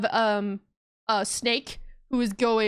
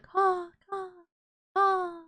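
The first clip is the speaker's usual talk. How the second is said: higher cawing like a distant gull; bird caws; 7 semitones higher, much slower than usual. Acted. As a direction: caw like a seabird over the waves. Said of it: "you hear birds in the distance".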